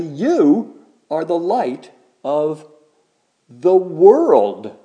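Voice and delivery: "you" stressed, slow and deliberate; emphatic you. Shown as a demonstration. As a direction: say "you are the light" slowly, weighing each word.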